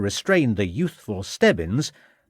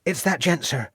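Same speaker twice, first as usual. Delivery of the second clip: high-pitched lad, mildly relieved; relieved servant boy; 7 semitones higher than usual. A narrator's reading. Freed servant tells the policeman, relieved. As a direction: report it in a high boyish voice. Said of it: "said a relieved Stebbins".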